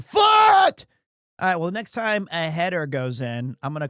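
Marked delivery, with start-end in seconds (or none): 0.0-0.8 sudden loud, high yell on the last word; exaggerated outburst; mock shout. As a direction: burst out shouting to hammer the point. Mock outburst insisting on the name.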